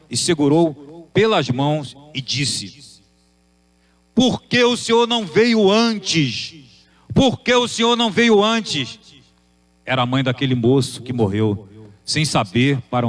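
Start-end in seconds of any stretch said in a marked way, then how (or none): 4.1-9.0 a mother in tears, begging to know why he came late; high, tearful lament; tearful anguished plea. Acted. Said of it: "com seus olhos molhados de lágrimas".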